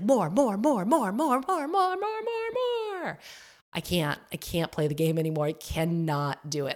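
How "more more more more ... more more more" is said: rising higher and higher with each more; greedy consumer. Retold or imitated. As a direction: climb in pitch like a greedy, insatiable consumer. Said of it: "the unconscious consumption".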